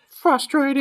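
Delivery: very high parroting falsetto; Popo. Acted. Host as Popo echoing Fink's complaint, high falsetto. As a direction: squeak it like a mocking puppet. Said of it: "Popo's like".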